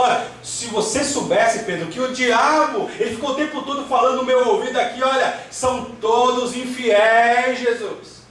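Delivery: high, urgent preacher's retelling; Jesus. Acted. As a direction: warn a disciple with emphatic force. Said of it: "Por isso que Jesus fala". (voz de Jesus advertindo, em tom alto de pregador)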